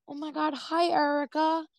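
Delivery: bright, high sweet greeting; very nicely. Retold or imitated. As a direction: greet her like a thrilled fan, sweetly. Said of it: "Like, just very nicely".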